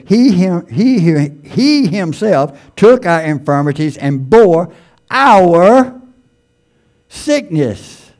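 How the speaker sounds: slow, emphatic scripture reading; preaching scripture. Acted. Preacher reading the verse to the congregation, slow and emphatic. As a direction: read it out like a preacher at the pulpit.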